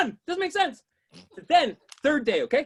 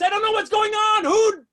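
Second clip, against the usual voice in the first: ordinary delivery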